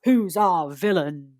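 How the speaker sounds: slow, drawn-out spooky question; scary voice. Pretend. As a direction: loom ominously over each word. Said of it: "I still need to get some scary voice for this".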